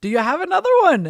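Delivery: high, loud, fast eager question; hammed-up stage prompt; pirate host. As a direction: imitate a host egging on a kid. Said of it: "He goes".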